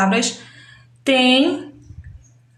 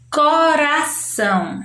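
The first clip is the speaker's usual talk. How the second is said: "Coração" slow and higher, as "co-ra-ção"; deliberate three-beat "Coração", pitched up; syllable by syllable; 4 semitones higher, slower than usual. Shown as a demonstration. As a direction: stretch each syllable of "Coração". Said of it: "Eu pronuncio três sons".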